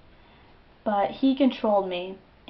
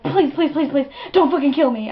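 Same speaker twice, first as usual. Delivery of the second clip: high, loud, panicked pleading; hammed-up terrified begging; freaking out; 6 semitones higher, 9 dB louder than usual. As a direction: beg frantically for your life. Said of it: "I'm, like, freaking the fuck out".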